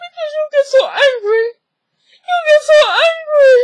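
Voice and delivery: loud, very high mocking whine; mock tearful mother. Mother blaming her daughter's anger, mock squeaky wail. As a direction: imitate a weepy mom, squeaking with overdone distress.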